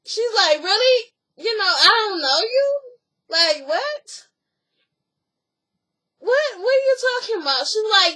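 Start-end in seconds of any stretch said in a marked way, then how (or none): 0.6-7.7 high, loud, disbelieving; imitating an outraged cast member. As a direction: act baffled and offended at the accusation. Acted quarrel between cast members.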